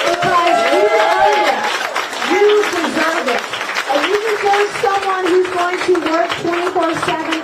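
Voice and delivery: building from a firm push to a full, loud, high rallying cry; rally shout. Real, not acted.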